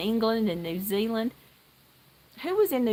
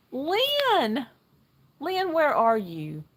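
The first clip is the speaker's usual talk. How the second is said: excited; 6 semitones higher, 3 dB louder, much slower than usual. Real, not acted. Calling out to viewer.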